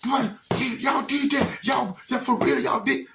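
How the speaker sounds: high, whooping excited cry; excited old man. Retold or imitated. Old man proudly whooping at the boy, high and excited. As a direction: cheer it out like a proud uncle. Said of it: "he got excited".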